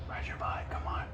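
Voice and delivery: unvoiced hushed coaxing; whisper. Real, not acted. Urging toddler softly.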